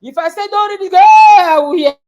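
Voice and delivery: high, loud shouted scolding; shouting mother. Retold or imitated. Mother shouting rules at daughter, angry shout. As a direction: shout the rule at her. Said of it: "I said".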